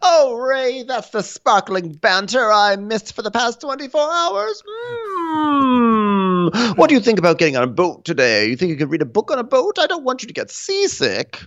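high, cooing falsetto, hammed up; mock doting wife. Wife missing Ray's banter, mock adoring. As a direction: fuss over him in a put-on squeal.

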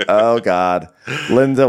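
ordinary talk

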